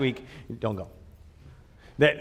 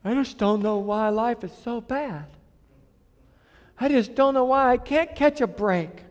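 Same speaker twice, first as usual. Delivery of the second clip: mock whining; 8 semitones higher than usual. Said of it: "Quit looking around going".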